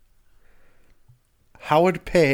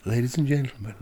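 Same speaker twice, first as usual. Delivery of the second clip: booming game show intro, building to a shout; game show announcer; 3 semitones higher, 5 dB louder than usual. Pretend. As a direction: announce the contestants like a TV host. Quiz show opening announcement.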